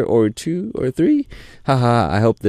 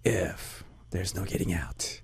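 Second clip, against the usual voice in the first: mock-sinister whisper of no escape; creepy joking whisper, much softer; spooky whisper; 2 semitones lower, 15 dB quieter than usual. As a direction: whisper it like a playful threat. Joking menace welcoming new member.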